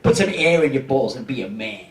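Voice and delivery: lightly voiced tough dad; gruff dad. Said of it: "he said".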